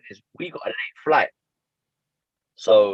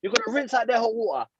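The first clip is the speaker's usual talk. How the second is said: mum barging in, voice pitched higher; bossy mum; 8 semitones higher than usual. Retold or imitated. Mum bursting into the shower, pitched-up nagging. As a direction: hurry him up like a nagging mother. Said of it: "She's bust the door".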